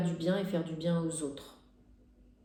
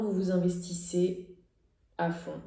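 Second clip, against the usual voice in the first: normal talking voice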